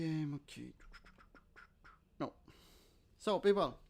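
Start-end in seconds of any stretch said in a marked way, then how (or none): none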